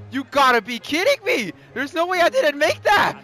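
ordinary talk

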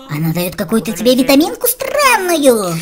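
usual speaking voice

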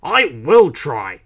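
loud, slow, defiant retort; indignant, forceful comeback; snapping back. A narrator's reading. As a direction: fire back with each word stressed. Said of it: "James snapped back".

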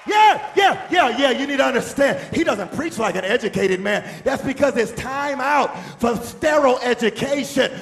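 loud, high preacher shout; full-force preaching. Real, not acted. Preacher stirring the congregation to give glory, full-force preaching. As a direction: preach at the peak of fervour.

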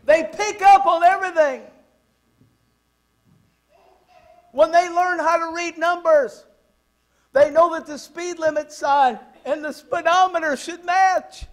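loud, high preacher's proclamation; emphatic exclaiming. Real, not acted. Preacher warning parents what kids notice, loud exclaiming. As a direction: belt it out to the whole room.

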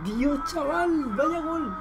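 a very slow, high, drawn-out awe; awed. Real, not acted. Fan marvelling at a golazo, slow and high in awe. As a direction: stretch it out, still stunned by the strike.

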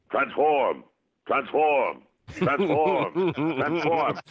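imitated Transformers call; Optimus toy voice. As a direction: boom it out like the toy. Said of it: "I heard my voice on the toy".

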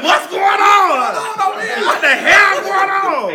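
high, loud, slow startled yell; hammed-up alarm, shouted and laughing. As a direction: bellow it like waking up in a fright. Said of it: "She done got up like".

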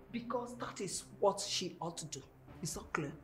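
low, very soft insistence; stern quiet order. Acted. Mother insisting her daughter comply, quiet and stern. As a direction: lay down the law quietly.

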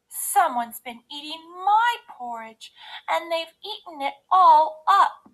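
put-on Baby Bear. A narrator's reading. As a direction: wail as the little bear, building to the end. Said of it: "cried Baby Bear".

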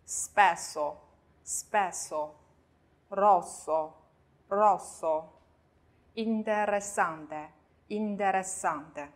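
spesso, rosso, interessante with hissed ss; very slow model of spesso, rosso, interessante, ss unvoiced; spesso, rosso, interessante spaced out, s never buzzed; double s voiceless. Shown as a demonstration. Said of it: "那么我们同样发斯的音".

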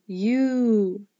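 U held long as "yoo"; long U sound. Shown as a demonstration. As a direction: draw U out into a long, clear "yoo". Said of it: "say the long sound of the letter U".

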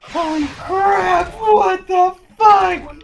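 slow, high shocked yell; shocked shout. Real, not acted. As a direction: draw it out in disbelief. Shocked reaction in game.

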